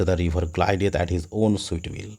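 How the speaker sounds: slow, measured reading of the verse; poem recitation. Shown as a demonstration. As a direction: read the line out slowly like a poem. Reading poem line aloud.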